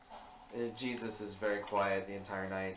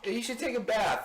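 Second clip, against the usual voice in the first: nagging mom; 10 semitones higher than usual. Retold or imitated. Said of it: "Mary was like".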